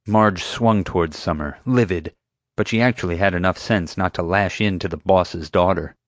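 ordinary delivery, nothing marked

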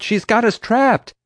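high, loud, drawn-out cry; slow down and wail it in alarm; desperate high shout; panicked yell. A narrator's reading. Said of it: "I yelled".

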